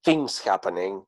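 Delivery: normal talking voice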